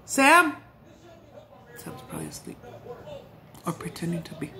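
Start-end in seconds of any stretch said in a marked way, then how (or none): 0.0-0.6 high, loud call. Real, not acted. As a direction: shout the name across the house. Calling out to Sam.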